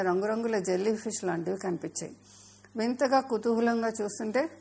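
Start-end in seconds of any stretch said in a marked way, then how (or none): none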